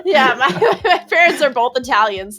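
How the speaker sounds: real amused laughter fading out; laughing. Real, not acted. Laughing about parents' voice.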